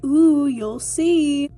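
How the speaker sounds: slow, teasing sing-song. Retold or imitated. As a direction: tease them, drawn out. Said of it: "they were like".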